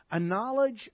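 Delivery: ordinary delivery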